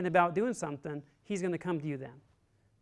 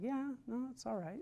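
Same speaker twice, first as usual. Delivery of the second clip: put-on soft, high kid's reply; imitated young son; 7 semitones higher, 10 dB quieter than usual. Son telling dad about math class, small high noncommittal voice. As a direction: shrink into a small, higher child's voice. Imitates his son's evasive answer.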